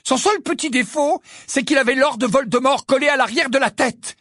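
high, loud outburst; loud, high-pitched defiant retort; loud interruption. A narrator's reading. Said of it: "l'interrompit Harry à voix haute".

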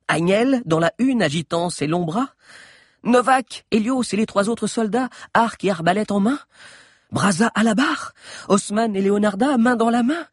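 high-pitched wondering questions; excited, rising roll call; amazed excitement. A narrator's reading. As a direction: marvel at each rescuer spotted, voice lifted high. Astonished sighting of rescuing friends.

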